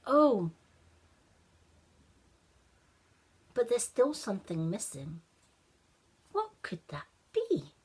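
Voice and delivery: put-on a child frowning at his snowman; puzzled boy Ashton. Ashton notices something missing.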